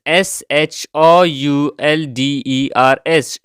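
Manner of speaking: slow letter-by-letter spelling; spelling aloud for learners; spelled out. Shown as a demonstration. Spelling out the word.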